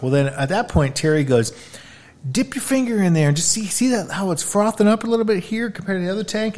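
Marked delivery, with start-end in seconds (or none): 2.3-6.6 higher, mock southern guide voice; southern twang. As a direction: play the old Kentucky guide with a twang. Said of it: "in this southern, uh, twang".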